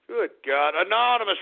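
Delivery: usual speaking voice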